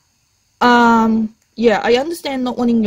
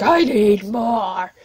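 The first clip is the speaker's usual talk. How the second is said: stretched slow, whining for more; drawn-out whine; much slower than usual. Retold or imitated. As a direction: drag out I need more like a hungry fan. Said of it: "it's like".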